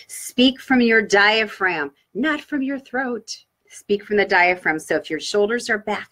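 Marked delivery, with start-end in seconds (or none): none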